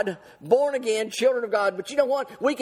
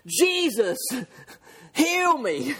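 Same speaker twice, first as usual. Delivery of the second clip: imitated plea, pitched up high on "heal me"; crying out louder; 4 semitones higher, much slower than usual. Blind man refuses to hush, cries out louder. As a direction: cry it out as the blind man, insistent. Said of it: "He cried out, the Bible says, the louder".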